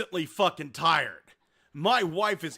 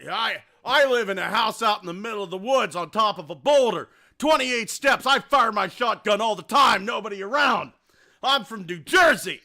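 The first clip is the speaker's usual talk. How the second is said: loud bragging; 3 semitones higher, 8 dB louder than usual. Retold or imitated. Inspector bragging loudly.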